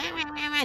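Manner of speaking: high, whiny wah-wah-wah; imitating a nagging inner voice, high-pitched; mock nagging wah. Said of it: "nagging at me".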